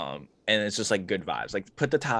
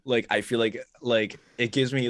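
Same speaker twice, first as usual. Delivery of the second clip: ordinary delivery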